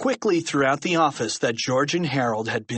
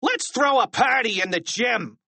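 high, celebratory teacher shout; gleeful high-pitched shout; 10 semitones higher than usual. A narrator's reading. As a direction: yell it like announcing a party. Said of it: "shouted Misdemeanor".

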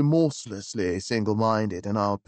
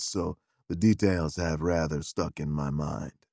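lower, unhurried manservant voice; a low, deferential gentleman's gentleman; valet Beauregard; 5 semitones lower than usual. Acted. Valet advising his employer, in a lower deferential voice.

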